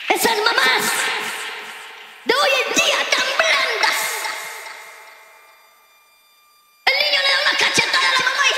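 high shouted rebuke; preaching shout. Real, not acted. Pastor shouting at permissive mothers in church.

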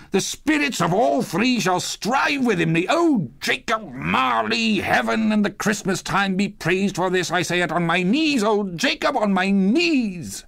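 high, fervent thanksgiving; overwhelmed Scrooge. A narrator's reading. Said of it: "his broken voice would scarcely answer to his call".

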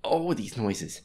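loud frightened cries; real fright at the noises; scared. Real, not acted.

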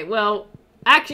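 ordinary talk, nothing marked

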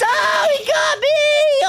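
high, loud, drawn-out wail; panicked scream. Real, not acted. Player caught by the monster, panicked scream. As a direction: scream it out, stretching the words.

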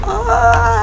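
high mock yelp of fright; imitated scream. Showing viewers her own jump, a mock high yelp. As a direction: act out how tense you get. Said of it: "You're like".

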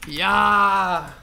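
loud triumphant yes; victory cheer. Real, not acted. Winning the round. (lauter Jubelschrei)

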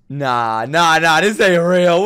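building from normal voice to loud protest; rising disbelief. Real, not acted.

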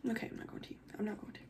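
very soft, near-whispered self-talk; holding back tears. Real, not acted. Vlogger refusing to cry on camera, tiny tearful murmur. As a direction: hush yourself so the tears stay in. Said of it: "gonna make me cry".

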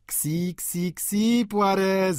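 high, teasing goading call. A narrator's reading. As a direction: egg him on like urging a dog. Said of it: "zawołał malarz".

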